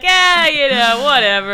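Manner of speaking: lazy shrug. Retold or imitated. Mocking a lazy worker.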